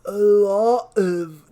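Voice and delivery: droopy defeated mumble, slow; loser voice. Acted. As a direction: drag it out like a sad loser. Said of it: "figure out how to do a different, like, s-, loser voice".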